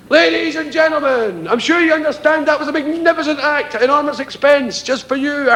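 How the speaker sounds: high, grand announcer voice; ringmaster. Retold or imitated. Ringmaster addressing the circus audience, high showman voice. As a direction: sell it to the crowd. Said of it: "In came the ringmaster".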